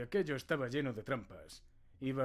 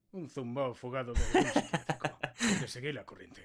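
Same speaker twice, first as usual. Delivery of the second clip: laughing mockery; 8 dB louder than usual. Player's character mocks the stranger.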